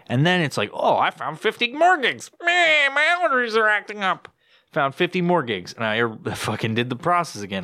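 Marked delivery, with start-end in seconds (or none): 0.7-4.3 high, whiny put-on voice for the program; mock computer voice. Podcaster mocking his cleaning program in a high whiny voice. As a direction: play the cleaner app as a whiny little creature.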